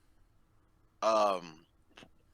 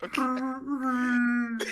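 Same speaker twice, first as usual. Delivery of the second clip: laughing; 10 semitones higher, 3 dB louder than usual. Real, not acted.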